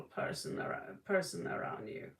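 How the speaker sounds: hushed, fast whispered warning; hushed warning about an evil person. Real, not acted. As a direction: drop to a secret whisper.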